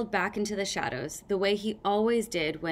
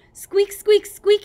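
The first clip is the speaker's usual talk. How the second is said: high, loud mock squeaking; loud hammed-up squeak; shouted squeaks; 12 semitones higher, 12 dB louder, slower than usual. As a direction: squeak like a tiny scavenger, shouting. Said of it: "he shouted".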